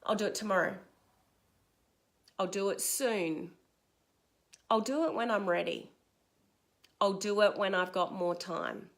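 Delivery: mumbled-to-yourself excuses, understated; putting-it-off self-talk. Acted. Acting out procrastinator's self-talk excuses.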